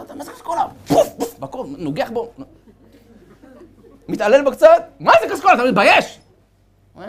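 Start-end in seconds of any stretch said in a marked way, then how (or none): 0.0-1.4 mocking king. King mocks son, hitting. 4.1-6.2 mock scolding king.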